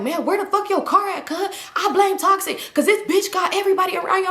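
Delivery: high-pitched, mock-exasperated rant; exasperated blaming. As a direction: imitate a fed-up man's complaint. Acted irritated man blaming her friend.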